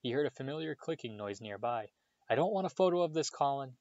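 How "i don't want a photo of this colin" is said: higher, quick objection; loud protest. A narrator's reading. Harry objecting to Colin taking a photo, mildly loud. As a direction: raise the voice a little in hurried protest. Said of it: "he said loudly".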